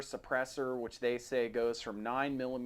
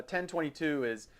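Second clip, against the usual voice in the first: ordinary delivery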